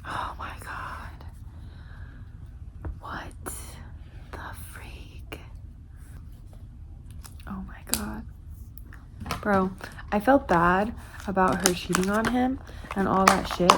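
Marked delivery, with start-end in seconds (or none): 0.1-8.2 shocked whisper. Real, not acted. Shocked reader reacting to twist.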